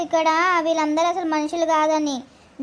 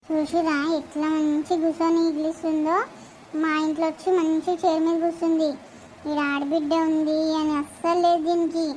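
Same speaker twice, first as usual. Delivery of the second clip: a lightly voiced, low, sneering sister-in-law; 3 semitones lower, 3 dB quieter than usual. Sister-in-law grumbling about the guest in the good chair, with mild contempt. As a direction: mutter the complaint with contempt.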